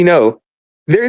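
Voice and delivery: normal talking voice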